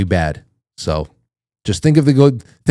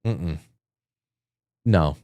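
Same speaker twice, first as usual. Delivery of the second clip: mock disapproving groan; 6 semitones lower, 8 dB quieter than usual.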